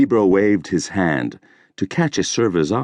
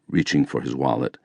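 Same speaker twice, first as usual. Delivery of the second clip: ordinary delivery, nothing marked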